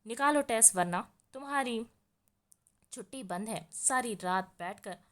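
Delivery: usual speaking voice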